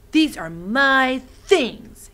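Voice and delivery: put-on loud, slow and deliberate boy's warning; said loudly. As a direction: lay down the law, loud and slow. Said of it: "he said loudly".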